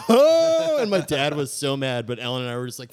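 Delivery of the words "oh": very high, very loud laughing "Oh!"; cheering a sister's comeback; laughing whoop. Retold or imitated. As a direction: whoop with glee. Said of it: "I was like".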